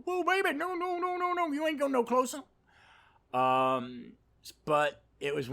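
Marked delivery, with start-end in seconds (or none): 0.1-2.4 high, loud panicky self-warning; high frightened squeak; inner voice of fear. Retold or imitated. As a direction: squeal at yourself to back away from the edge. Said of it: "My fear of heights kind of".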